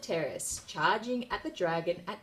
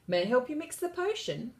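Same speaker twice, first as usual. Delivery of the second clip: little princess voice; 7 semitones higher than usual. A narrator's reading. Princess asking eagerly.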